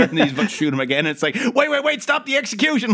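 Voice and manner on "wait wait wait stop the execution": panicked. Retold or imitated. Panicked retold plea.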